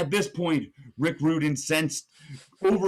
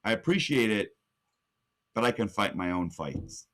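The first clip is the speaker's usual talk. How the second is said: lightly voiced wrestler's reply; 6 semitones lower than usual. Chyna on the phone to her rescuer, lightly voiced, low. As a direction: lightly play Chyna answering, low and subdued. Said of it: "Grabs the phone and says".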